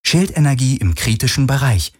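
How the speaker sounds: lightly voiced ship's computer warning. A narrator's reading. Ship reports failing shields to its crew, lightly voiced computer alert. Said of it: "meldete das Schiff".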